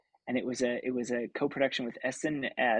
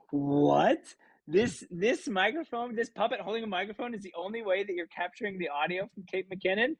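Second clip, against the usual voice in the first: incredulous surprise; 6 semitones higher than usual. Retold or imitated. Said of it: "And I was like".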